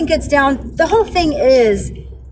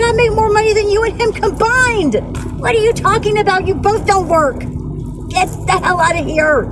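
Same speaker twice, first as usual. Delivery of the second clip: raised pitch, indignant retort; angry comeback; 6 semitones higher than usual. Retold or imitated. Postal worker retelling a clash outside court, angry comeback. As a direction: throw it back heatedly in a higher voice.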